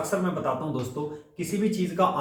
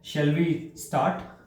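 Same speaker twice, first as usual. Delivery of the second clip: very slow model phrase; much slower than usual. Shown as a demonstration. Teacher modelling the phrase very slowly for students. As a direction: stretch shall we start out very slowly. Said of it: "इस तरीके से आप शुरुआत ले सकते हैं".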